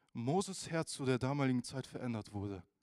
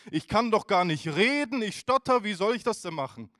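higher, loud, pleading excuses; 7 semitones higher, 9 dB louder than usual. Retold or imitated. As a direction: protest you can't do it, voice high and loud. Moses making excuses.

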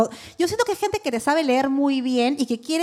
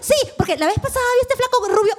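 much higher, eager mock gush; hammed-up eager believer voice; eager gushing client; 11 semitones higher, faster than usual.

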